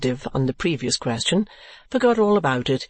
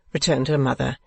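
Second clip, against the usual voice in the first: plain reading voice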